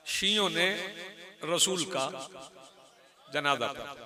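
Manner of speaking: phrase by phrase, pausing after शियों ने and after रसूल का; soft, with deliberate gaps between शियों ने, रसूल का and जनाजा पढ़ा; measured repetition. Real, not acted. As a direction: break शियों ने, रसूल का, जनाजा पढ़ा into three falling pieces. Repeats the claim in pieces.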